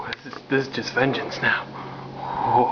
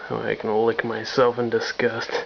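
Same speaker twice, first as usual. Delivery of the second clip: normal talking voice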